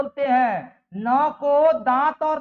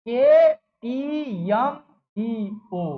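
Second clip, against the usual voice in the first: के टी एम spelled very slowly, each letter apart; slow letter-by-letter spelling; much slower than usual. Shown as a demonstration. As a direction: draw out के टी एम like a teacher dictating. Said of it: "teacher spells out letters".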